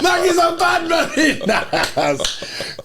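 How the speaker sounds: high, slow, laughing yardie shout; Jamaican yardie voice. Retold or imitated. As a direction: hype it up like a Jamaican dancehall MC. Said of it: "I was on my [name] thing".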